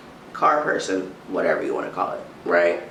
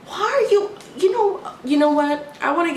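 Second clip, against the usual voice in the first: sharply higher indignant start; squeaky outraged question; exasperated; 10 semitones higher than usual. Real, not acted. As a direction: burst out in disbelief. Exasperated at clerk.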